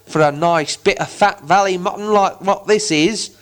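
troll grumbling to his fellow trolls. Acted. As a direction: complain like a greedy troll. Troll complaining to his mates.

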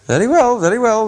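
high sing-song very well; high mock agreement. A narrator's reading. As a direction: humour him in a high mocking tone. Elves answering mockingly.

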